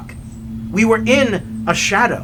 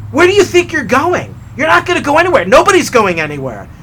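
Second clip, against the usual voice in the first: higher, louder annoyed outburst; indignant challenge; 7 semitones higher, 5 dB louder than usual. Retold or imitated. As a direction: call out the idiot driver, mock-indignant. Acted indignant outburst at driver.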